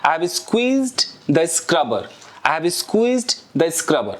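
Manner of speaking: slow, careful English model sentence. Shown as a demonstration. Teacher modeling an English phrase slowly for students. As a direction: spell it out slowly for learners. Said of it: "ये squeeze है".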